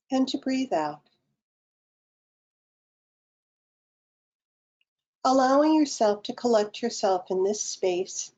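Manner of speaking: calm, unhurried meditation guide with long pauses; guided meditation. Acted. Guide leading online participants through breathing and self-massage, guided meditation. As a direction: lead them gently into the breath, phrase by phrase.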